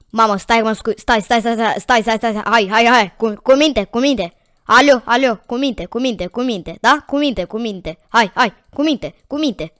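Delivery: loud, rapid-fire repeated commands; urgently shushing an unruly animal, words tumbling out; frantic scolding. Real, not acted. Player tries to make the game animal behave, frantic scolding.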